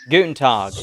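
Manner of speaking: Southern-accented German; German greeting with a Southern twang. Shown as a demonstration. Game master retrying for friends, German-Southern accent. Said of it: "imagine a German was from the South".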